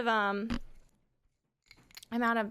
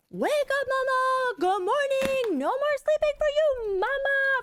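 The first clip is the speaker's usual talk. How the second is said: put-on high, loud sing-song little boy shouting; toddler wake-up call; 5 dB louder, slower than usual. Toddler calling mom from his bed, high loud sing-song. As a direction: belt it like a kid waking mom. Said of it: "He was going".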